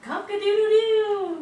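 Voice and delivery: high, slow, loud crow; rooster crow. Acted. Rooster crow in sound review.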